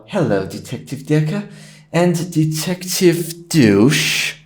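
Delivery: lightly voiced suave British devil greeting; hint of a British TV devil, stretching the last name; Lucifer from the series. Greeting the detectives as in the series, lightly put-on British accent. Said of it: "you have watched the, uh, Lucifer series".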